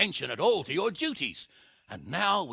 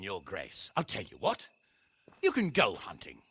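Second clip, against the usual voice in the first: normal talking voice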